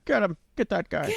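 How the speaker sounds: high, hammed-up order; mock George over a mic. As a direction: call it out like a villain on the radio. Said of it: "George come through the microphone saying".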